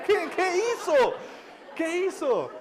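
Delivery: pregunta alarmada, aguda y alargada; alarmado. Retold or imitated. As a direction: exagera la incredulidad del marido. Marido alarmado pregunta qué pasó. (incredulidad actuada, voz muy aguda)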